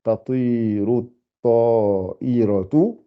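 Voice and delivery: "Tatiru at-ta'iratu" slowly, with the ending -atu; drawn out "Tatiru at-ta'iratu", every syllable clear; slow corrected reading. Shown as a demonstration. Teacher correcting her reading for the class, slow. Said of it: "ta'iratu plutôt".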